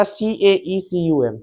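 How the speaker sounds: slow, soft spelling out, सीएईसीयूएम as C-A-E-C-U-M; teacher spelling out the word slowly, letter by letter; spelled letter by letter. Shown as a demonstration. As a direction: read the letters one by one, quietly. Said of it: "pronunciation से spelling".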